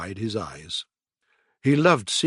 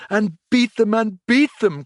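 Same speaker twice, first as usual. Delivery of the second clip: much higher and louder, gleeful; ecstatic shout; 10 semitones higher, 6 dB louder than usual. A narrator's reading. Toad dancing round the room, ecstatic shouting. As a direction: beat them at the top of the voice. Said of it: "cried the Toad in ecstasy".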